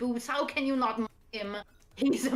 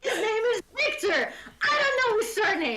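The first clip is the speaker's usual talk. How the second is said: whiny insistent friend; 7 semitones higher than usual. Retold or imitated. Voicing Jace's insistence.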